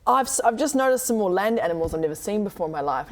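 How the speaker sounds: ordinary talk, nothing marked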